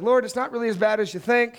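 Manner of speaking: high, whiny protest to the Lord; mock pleading excuse. Sinner arguing with the Lord, whiny and high. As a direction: wheedle like you can talk your way out.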